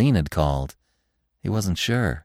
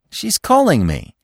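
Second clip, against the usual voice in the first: urgent explanation; 9 semitones higher than usual. A narrator's reading. Bambi pleading to the stag.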